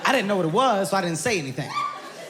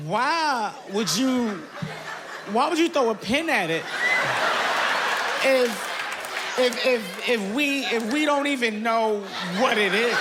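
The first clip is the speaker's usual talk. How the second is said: scared, near tears; 3 semitones higher, slower than usual. Retold or imitated. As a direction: plead like a scared man on the edge of crying. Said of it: "So I got a little emotional".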